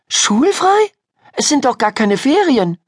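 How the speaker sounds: put-on very high girl's voice, puzzled; incredulous. Ida doubting the friend's news of free weeks, incredulous. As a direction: question it in high-pitched disbelief.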